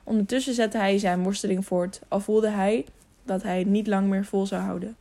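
ordinary delivery, nothing marked

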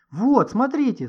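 loud, high-pitched cry; sudden shout. A narrator's reading. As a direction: call out urgently to make them look. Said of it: "закричал вдруг Гошка".

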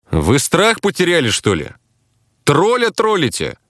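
high-pitched angry challenge; indignant outburst. A narrator's reading. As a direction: round on the friends who are winding you up. Narrator's hero snaps at teasing friends.

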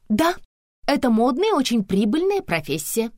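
high, grand, self-satisfied claim; pompous declaration. A narrator's reading. Tonya boasting of her profession, pompously. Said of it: "с пафосом заявила Тоня".

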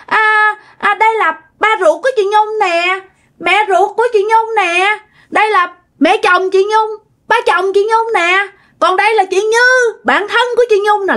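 put-on high child showing off what they know; imitated kid voice, high and sing-song; little child's voice. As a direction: point out each grown-up like a five-year-old. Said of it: "trả lời với giọng một đứa con nít".

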